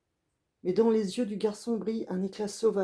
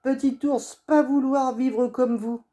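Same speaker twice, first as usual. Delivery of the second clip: shouting; 6 semitones higher, 7 dB louder than usual. Acted. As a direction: fling it out as a farewell. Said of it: "en criant".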